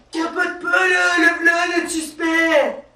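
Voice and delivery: high urgent call-out, slowing on suspect; colleague calling out. Retold or imitated. Colleague calls out to the cop that the suspect is right there, high and urgent.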